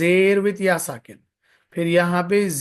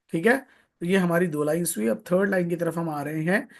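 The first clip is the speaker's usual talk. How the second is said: ordinary talk, nothing marked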